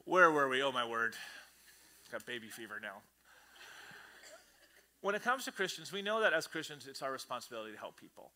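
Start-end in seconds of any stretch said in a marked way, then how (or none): none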